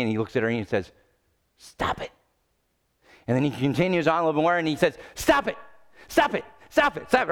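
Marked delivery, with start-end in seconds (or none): 1.6-2.1 breathy, hushed command; quiet deadpan stop; flat whispered stop. Acted. Therapist repeats the order. 5.1-7.3 high, urgent string of stop it; comic frantic stop it; rapid repeated stops. Acted. Therapist repeating more urgently.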